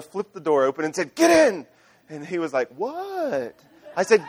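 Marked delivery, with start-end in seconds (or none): 1.1-1.7 high, loud urgent command; urgent yell. Retold or imitated. Father yelling at endangered son. 2.8-3.6 high-pitched, drawn-out clueless question; clueless reply. Retold or imitated. Retold clueless son replying.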